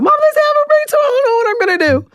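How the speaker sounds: high, fast, frantic rush of words; panicked blurting. Retold or imitated. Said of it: "I just blurted out".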